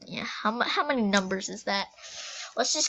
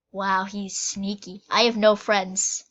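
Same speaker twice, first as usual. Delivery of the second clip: usual speaking voice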